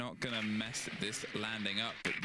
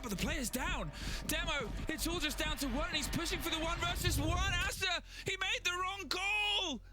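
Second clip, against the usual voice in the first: very high, sustained excited call; excited play-by-play. Real, not acted. Caster calling a one versus one, excited and high-pitched. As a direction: ride the clutch moment at a high pitch. Live cast of a round's climax.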